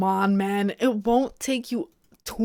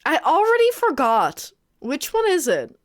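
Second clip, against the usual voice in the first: high-pitched, dismayed outburst; exasperated at self; 6 semitones higher than usual. Real, not acted. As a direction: wail that it slipped your mind. Forgot pronunciation just looked up.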